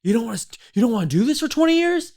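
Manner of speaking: high-pitched incredulous questioning, rising sharply; mock freak-out. Shipmates hearing he's getting out, acted freak-out. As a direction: imitate colleagues aghast at the news. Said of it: "like, freaking out".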